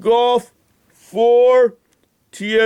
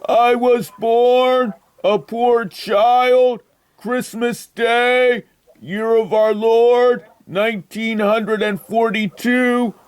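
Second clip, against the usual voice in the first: slow storytelling; slower than usual. Acted. Old caller's rambling life story.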